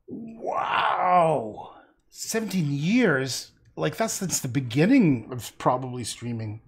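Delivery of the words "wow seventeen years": amazed disbelief. Real, not acted. Reacting to a long streaming career.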